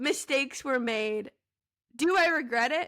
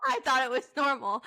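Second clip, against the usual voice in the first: ordinary delivery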